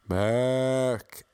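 drawn-out American "Mark" sounding like "Merrick"; American mispronunciation. Retold or imitated. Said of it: "Accent issues? ... Not Merrick".

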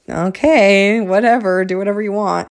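mock-indifferent "have it your way"; dismissive going-along. Wife giving in to husband's long-shot offer, dismissive.

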